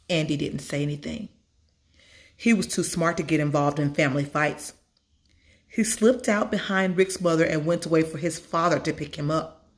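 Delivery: plain reading voice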